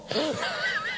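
high, breathy burst of laughter at his own joke. Real, not acted. Pastor laughing at his own joke to the congregation, high burst of laughter.

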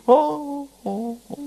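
high, breathy-free rising oh's of delight; moved exclamation. Real, not acted. Pastor overwhelmed by the woman's thanks, rising joyful oh. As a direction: let the oh's lift up with joy.

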